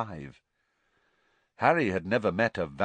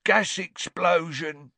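a slow, higher grunted answer; 9 semitones higher, 5 dB louder, slower than usual. A narrator's reading. Ernie answers Stan with a slow grunt. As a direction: grunt it out flatly. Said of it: "grunted Ernie".

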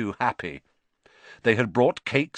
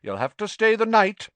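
brisk matron; 11 semitones higher, 4 dB louder than usual. Acted. Matron orders boy to stay.

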